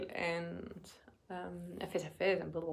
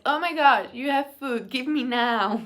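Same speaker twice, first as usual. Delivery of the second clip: higher, much louder greedy demand for food; pet's voice begging; 4 semitones higher, 11 dB louder than usual. Retold or imitated. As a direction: voice a hungry pet who spots food. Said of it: "She's like".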